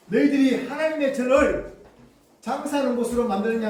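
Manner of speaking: loud, high indignant rebuke; angry Jesus. Acted. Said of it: "이렇게 꾸짖으셨습니다". (노하여 크게 꾸짖는 목소리)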